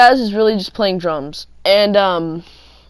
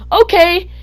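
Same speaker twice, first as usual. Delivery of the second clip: sweet, high "okay"; overly nice; 11 semitones higher, 3 dB louder than usual. Retold or imitated. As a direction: kill her with kindness. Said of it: "in the nicest way".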